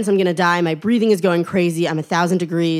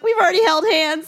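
pitched-up dry retort; wry high deadpan; 9 semitones higher than usual. Retold or imitated. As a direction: point out the obvious, dryly. Wry reply to hug offer.

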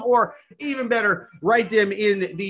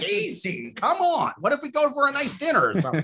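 pitched-up whiny plea, hammed; high mock pleading voice; 7 semitones higher than usual. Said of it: "I was like".

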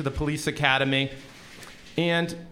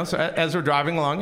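usual speaking voice

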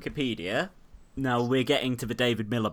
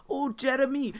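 put-on Welsh accent; 12 semitones higher than usual. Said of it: "I'm gonna do the Welsh accent now".

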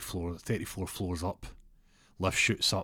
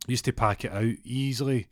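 ordinary delivery

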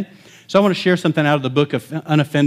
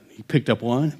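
usual speaking voice

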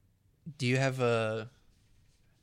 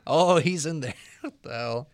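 loud, higher mock awe; mocking echo; 3 semitones higher, 6 dB louder than usual. Co-host mimicking the room's reaction, mock awe. Mocks the other's retold reaction.

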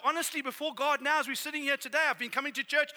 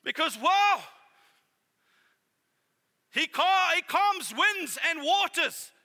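higher, louder, rising preaching; preaching climax; 5 semitones higher, 3 dB louder than usual. Real, not acted. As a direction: preach it with full force. Preaching climax about Jesus' power.

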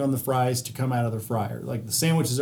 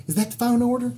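higher prissy Southern lady voice, the second line breathy; mocking Southern lady; 9 semitones higher than usual. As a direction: play the fussy customer holding up the line. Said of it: "this little cupcakey Southern woman".

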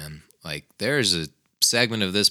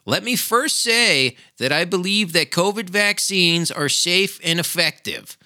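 high, sneering sheep-like parody of a politician; higher mocking voice for the prime minister's official line; 9 semitones higher than usual. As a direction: mimic him as a meek, obedient pawn. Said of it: "like a good little sheep".

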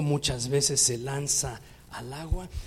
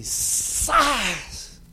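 sharp high smack sound; slap sound; 8 semitones higher, 7 dB louder than usual. Retold or imitated. The preacher imitates the backhand slap, a sharp loud smack. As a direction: snap out the slap noise. Y agarra así.

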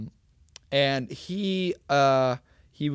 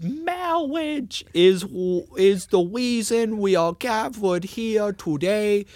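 slow, higher, put-on priest voice; Princess Bride priest; 6 semitones higher, slower than usual. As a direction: intone it like the movie's wedding priest. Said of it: "talking like the fucking priest from The Princess Bride".

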